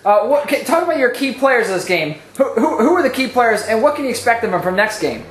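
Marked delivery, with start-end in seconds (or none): none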